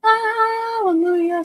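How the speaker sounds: slow, high, drawn-out praise; drawn-out hallelujah. Real, not acted. Finally finding the video, a slow high hallelujah. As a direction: stretch it out like a grateful shout to heaven. Relief when the link finally works.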